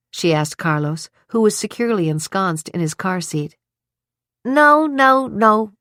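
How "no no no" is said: put-on high, loud sing-song child voice; sing-song toddler. A narrator's reading. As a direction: chant it like a two-year-old. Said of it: "in a sing-song voice".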